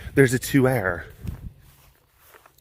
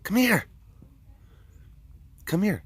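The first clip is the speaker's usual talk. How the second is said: coaxing call; 10 semitones higher than usual. Real, not acted. Calling lions.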